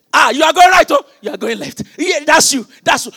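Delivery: loud, high excited shouting; mock crowd shouting. Cheering crowd voiced in sermon.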